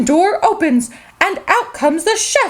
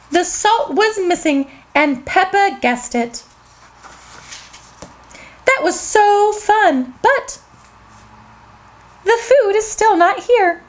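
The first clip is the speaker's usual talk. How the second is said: plain reading voice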